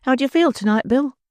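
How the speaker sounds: put-on concerned girl asking her man; Nancy asking. A narrator's reading. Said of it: "said the girl".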